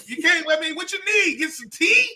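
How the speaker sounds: high-pitched mock solicitude; Anthony Anderson teasing. Comic imitating his friend offering help at breakfast, high mock concern. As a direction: fuss over him in a high voice. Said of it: "Anthony Anderson was killing me".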